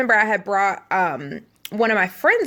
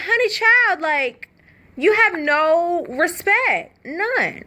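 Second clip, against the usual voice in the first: sassy scolding; 7 semitones higher, much slower than usual. Retold or imitated. Scolding roommate for dirty dishes.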